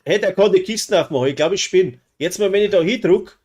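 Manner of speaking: lauter, höher, ungläubig aufgeregt; plötzlich verblüfft ausrufen; surprised. Real, not acted. Überraschte Kiste im Spiel. (überrascht und aufgeregt)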